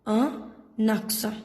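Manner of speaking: a naksa, the N carried onto aksa; linking demo. Shown as a demonstration. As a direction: join the N to the vowel: a naksa. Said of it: "it will be pronounced as a naksa".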